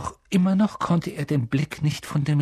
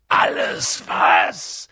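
low, slow, rough giant's roar; thundering; 8 semitones lower, much slower than usual. A narrator's reading. Giant throws the boy's question back, thundering. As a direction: thunder it back, drawn out and deep. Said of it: "donnerte Hagrid".

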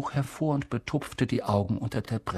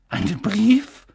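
weakening, defeated echo; faltering voice; 11 semitones higher, 5 dB louder than usual. Acted. Deflated professor repeating his words, faltering. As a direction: let the voice give out. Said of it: "mit erlahmender Stimme".

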